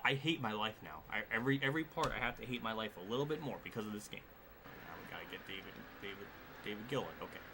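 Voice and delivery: usual speaking voice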